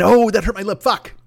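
normal talking voice

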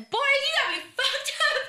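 high and loud, laughing through it; a mock outburst that cracks into laughter; laughing outrage. Retelling the store clerk clash, laughing mock outrage.

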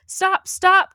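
high, loud frog cry. Acted. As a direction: yell after her as the little frog. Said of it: "cried the frog".

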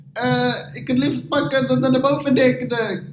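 high, slow, halting broken Dutch. Retold or imitated. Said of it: "in de meest gebroken Nederlands ever". (hoog en traag gebroken Nederlands)

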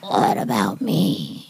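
deep, slow, raspy smoker voice. Shown as a demonstration. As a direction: drag it out low like a heavy smoker. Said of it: "do I have a smoker voice".

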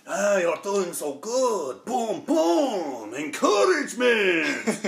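slow, breathy-free drawn-out coaching voice building to a shout; hammed-up instructor cheering a couple on, slowed right down; sexy yoga instructor. Friend imitates the movie's yoga instructor, slow mock-encouraging shout. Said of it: "comes up and says".

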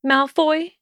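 high, tentative call of his name; calling through door. Acted. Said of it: "she called".

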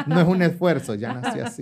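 fast, high, laughing through the words; giggling as he brushes off compliments. Real, not acted. Explaining to friend he was born pretty, laughing high.

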